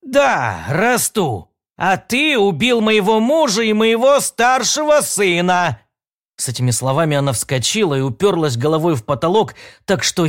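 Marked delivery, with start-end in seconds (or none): 0.0-5.8 angry shout. Acted. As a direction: yell it at him in rage. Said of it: "сказала сердито".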